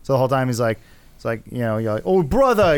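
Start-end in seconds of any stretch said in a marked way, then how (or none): 2.0-2.8 higher, exaggerated New York accent; overdone New York accent; hardcore New York accent. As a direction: mimic an Englishman attempting New Yorker. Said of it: "a very hardcore New York accent".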